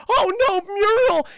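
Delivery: high, loud mock despair; hammed-up cartoon anguish; horrified wail. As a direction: imitate a dog crying out for its owner. Dog finds owner gone.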